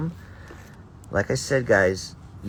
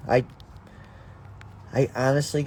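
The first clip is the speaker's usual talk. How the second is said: normal talking voice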